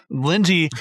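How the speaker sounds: normal talking voice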